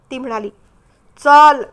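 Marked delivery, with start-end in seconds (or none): 1.2-1.6 loud, firm one-word order; stern command. A narrator's reading. Man ordering her to walk ahead, loud and stern. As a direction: allow no argument. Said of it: "त्याच्या आवाजात जरब होती".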